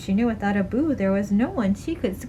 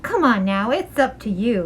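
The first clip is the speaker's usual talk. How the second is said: plain reading voice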